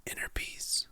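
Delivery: usual speaking voice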